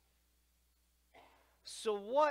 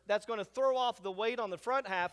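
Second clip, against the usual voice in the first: ordinary delivery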